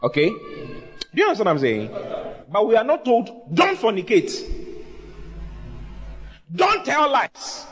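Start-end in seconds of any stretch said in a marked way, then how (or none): 3.5-7.7 high-pitched, spaced-out mock prohibitions; imitated scolding rule-giver; mock commandments. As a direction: hand down rules one by one, pitched up. Mimics a stern rule-giver.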